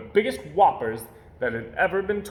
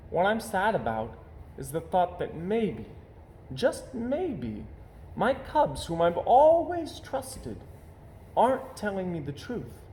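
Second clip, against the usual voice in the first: sad mother; 7 semitones higher than usual. Acted. Mama sad about lying cubs.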